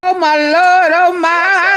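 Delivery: loud, high "oh my Lord" in disbelief; shocked. Real, not acted. Streamer reacting to a cringe ad, loud high disbelief. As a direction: react like the ad just scandalized you.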